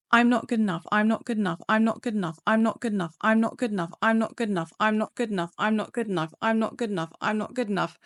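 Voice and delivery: rattled off over and over; rapid repetition. Shown as a demonstration. Therapist showing how to repeat a thought quickly. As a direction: repeat it fast until breath runs out. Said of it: "let me show you what I mean".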